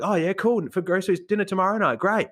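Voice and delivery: put-on high, excited questioning kid voice; eager teen. Teen quizzes shopper about groceries, high and eager. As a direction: pester him about his shopping. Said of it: "He's like".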